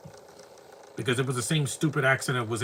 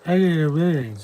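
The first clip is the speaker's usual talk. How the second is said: loud, high call to the screen; a quick loud hail; 5 semitones higher, 9 dB louder than usual. Real, not acted. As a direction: shout the name at the TV. Calling out to the screen.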